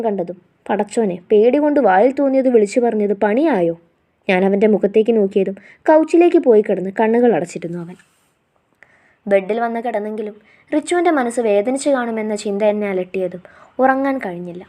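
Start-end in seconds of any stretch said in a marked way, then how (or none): none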